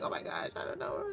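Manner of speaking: whispered, shy and nervous; flustered whisper. Acted. Nervous girl whispering to her friend about a crush. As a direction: hush it as if embarrassed. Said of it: "She's like".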